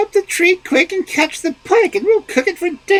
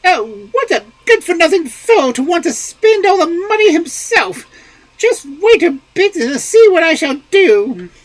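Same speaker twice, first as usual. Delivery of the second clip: put-on higher, louder furious old wife; angry wife; 8 dB louder than usual. A narrator's reading. Wife furious at husband over money, angry. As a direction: rage at the stingy husband, high and loud. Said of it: "The old woman was very angry".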